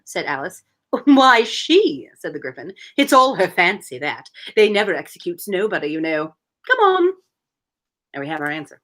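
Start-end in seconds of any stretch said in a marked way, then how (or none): 1.0-7.1 a hint of a mock Cockney griffin; lightly voiced Cockney griffin, amused. Griffin scoffing at the queen to Alice, mock Cockney. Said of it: "so I'm just doing a voice".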